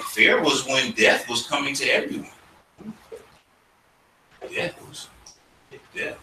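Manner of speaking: normal talking voice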